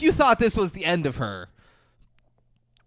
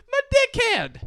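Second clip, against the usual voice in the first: much higher, hammed-up hooker squeal; squeaky hooker imitation. Host relishing the hooker's line, mock squeal.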